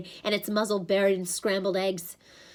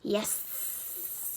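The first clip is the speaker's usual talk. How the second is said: soft, whispered snake hiss; reptilian voice; 10 dB quieter than usual. A narrator's reading. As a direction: hiss out the final S. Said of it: "the second reptilian voice said".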